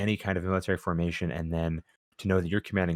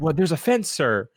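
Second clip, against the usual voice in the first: meek soldier reply; 8 semitones higher, 5 dB louder than usual. Retold or imitated. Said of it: "acted dialogue".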